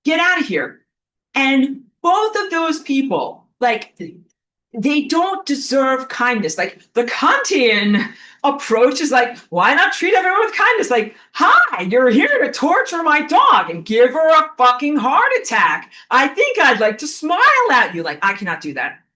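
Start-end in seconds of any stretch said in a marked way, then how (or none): 0.0-0.9 high-pitched exasperated order; angry dismissal. Acted. Acts out silent angry dismissal. 9.5-10.9 high, loud mock-sweet question; mocking kindness. Mocks the Kantian kindness approach. 11.3-18.0 very high, loud mock-cheery greeting; sarcastic friendliness.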